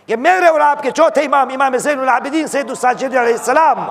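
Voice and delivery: high, rousing challenge to the congregation; impassioned preaching. Acted. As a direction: demand their attention from the pulpit. Preaches at full force.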